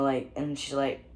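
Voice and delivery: ordinary delivery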